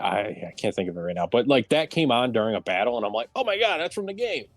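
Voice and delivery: ordinary talk, nothing marked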